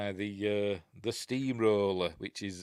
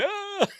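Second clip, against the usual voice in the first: imitated scream; 5 dB louder than usual. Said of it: "I just remember waking up going".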